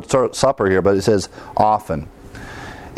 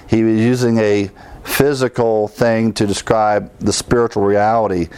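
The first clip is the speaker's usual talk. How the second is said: normal talking voice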